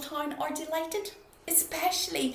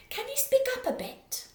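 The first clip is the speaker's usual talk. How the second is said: much higher, calling over; high asking shopkeeper; 14 semitones higher, 5 dB louder than usual. Acted. Shopkeeper asking a loud customer to speak up, high voice. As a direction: strain to hear, voice high and loud.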